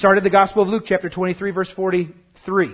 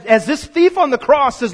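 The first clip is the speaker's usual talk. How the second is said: ordinary talk, nothing marked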